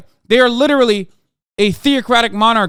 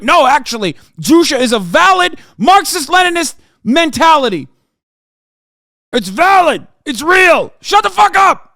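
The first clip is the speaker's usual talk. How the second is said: hammed-up shrill mock of a fanboy, much higher and louder, ending in a shout; mocking the chatter; 10 semitones higher, 7 dB louder than usual. Mocking a North Korea fan in chat with a hammed-up higher yell. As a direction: parody the troll at full yell. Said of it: "by being like".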